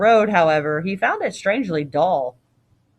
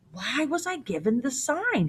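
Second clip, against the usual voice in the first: higher but softer indignant question; mildly acted frustration; storming; 5 semitones higher, 8 dB quieter than usual. A narrator's reading. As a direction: protest with pitch raised, voice held back. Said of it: "he stormed".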